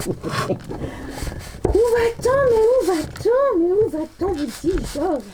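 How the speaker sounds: high, theatrical exasperation; mock dismay. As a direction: wail it like an outraged aunt. (indignation théâtrale, voix haute)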